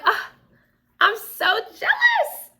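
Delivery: high, drawn-out jealous wail; jealous whine. Retold or imitated. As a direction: wail it, mock envious. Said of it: "and so I'm like".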